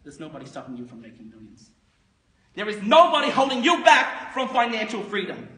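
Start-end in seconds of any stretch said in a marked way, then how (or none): 2.6-5.5 high, loud sales-seminar exhortation; rousing motivational shout. Acted. Broker urges listeners toward riches, rousing high shout. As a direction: fire them up at full voice. Motivational pitch to would-be millionaires.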